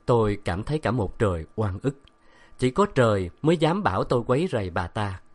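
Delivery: ordinary delivery, nothing marked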